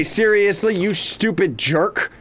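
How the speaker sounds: usual speaking voice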